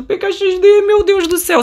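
high, amazed exclamation; excited awe. Real, not acted. Player shows viewers the game's tree, amazed. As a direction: marvel with the voice lifted high.

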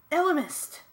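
high, loud exclamation; startled shout of recognition; alarmed cry. A narrator's reading. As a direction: call out the dreaded name. Ax cries out in fear.

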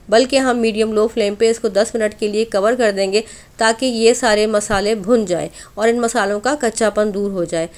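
ordinary talk

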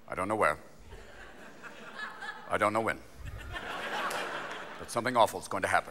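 low, soft official briefing voice; understated, ominous press briefing; Rumsfeld. Acted. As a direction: deliver it low and quiet, with long pauses. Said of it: "Rumsfeld comes out and goes".

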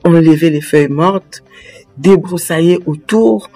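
usual speaking voice

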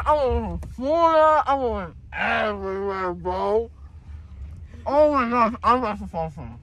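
dazed, teary rambling; drugged and emotional after surgery; groggy from anesthesia. Real, not acted. Drugged after wisdom teeth.

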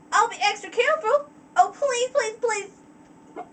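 high, eager begging as a little filly; pleading filly. Acted. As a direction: plead fast and high like an excited child. Filly begging to come along.

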